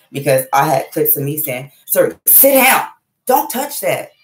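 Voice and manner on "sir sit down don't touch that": high, sharp parental command; aggressive scolding. Retold or imitated. Mother recalling clips of herself with her child, aggressive. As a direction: imitate yourself snapping orders at a child. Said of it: "Just talking in fucking aggression".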